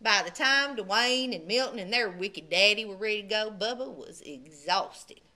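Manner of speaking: usual speaking voice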